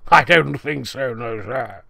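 ordinary talk

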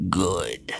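low, quiet satisfaction; menacing cyclops. A narrator's reading. Cyclops accepts Sam's surrender, low and menacing. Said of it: "The Cyclops stepped forward and said".

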